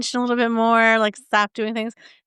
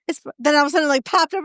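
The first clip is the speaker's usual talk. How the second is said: ordinary talk, nothing marked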